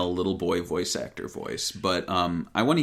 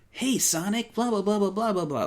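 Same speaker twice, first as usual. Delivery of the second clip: put-on high but more natural kid voice; lighter, less whiny cartoon falsetto; Tails; 13 semitones higher than usual. Shown as a demonstration. Podcaster showing the easier-on-the-ears Tails, natural high take. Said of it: "It's more natural sounding".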